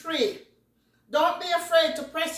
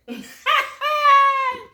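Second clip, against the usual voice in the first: high, loud burst of real laughter; a sudden loud laugh that jumps high; 12 semitones higher, 8 dB louder than usual. Real, not acted. Laughs at burning hot food.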